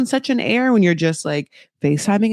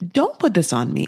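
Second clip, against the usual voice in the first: ordinary talk, nothing marked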